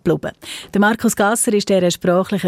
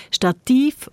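Stativ with a stressed, long final -TIV; stress on second syllable; 7 semitones higher than usual. Shown as a demonstration. Host explaining the listener's contrast, Stativ stressed on -tiv. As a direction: lean on the last syllable of Stativ. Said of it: "auf der zweiten Silbe".

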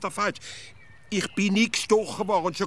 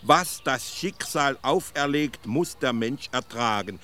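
put-on slow, measured recital of an old proverb; slow, dignified proverb recital; solemn recited saying; slower than usual. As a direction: intone it slowly like a wise uncle's motto. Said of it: "hat er also im Onkel Alfred gesagt".

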